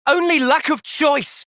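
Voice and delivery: high, slow shouted retort; angry shout. Acted. Hero shouts back at the enemy, furious. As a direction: hurl it back at them.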